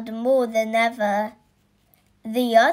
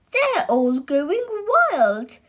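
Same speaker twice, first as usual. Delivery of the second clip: slow, higher, louder call; alarmed shout; 5 semitones higher, 4 dB louder than usual. Acted. The nurse crying out as the animals go wild, shouting. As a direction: cry out in alarm. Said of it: "shouted the nurse".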